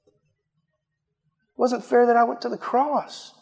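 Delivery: hushed, loving voice of Jesus; tender Jesus. Acted. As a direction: speak softly like a loving father. Jesus answering the complaining believer.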